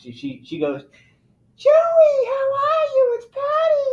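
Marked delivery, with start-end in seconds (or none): 1.6-3.9 very high, slow shouted greeting; high, slow shouting imitation of Patty; Patty impression. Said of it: "this is how she talks".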